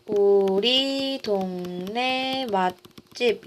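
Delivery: long drawn-out syllables; slow, evenly spaced write-along; slow dictation. Real, not acted. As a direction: stretch the words while writing them. Writes out the wrong example.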